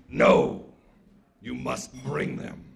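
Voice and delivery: low, rough giant's refusal; deep, grave godly order; stern sky god. Acted. Sky god refuses Anansi's plea, stern and deep.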